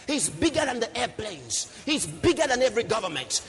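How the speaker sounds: high, loud list building to a long shout; triumphant preacher in full flow; full-force preaching. Real, not acted. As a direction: pile it up relentlessly, then roar the climax.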